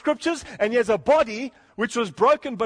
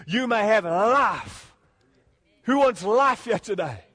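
louder, rising sermon appeal; preaching at full force; 4 semitones higher, 4 dB louder than usual. Acted. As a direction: drive the call home to the congregation.

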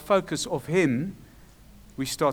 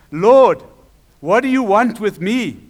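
loud, raised challenge to the Lord; acted outcry of a possessed man; crying out; 4 semitones higher, 8 dB louder than usual. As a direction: burst out on the first word, then demand. Possessed man running up to Jesus.

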